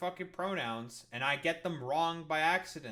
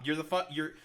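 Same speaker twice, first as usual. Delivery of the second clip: ordinary delivery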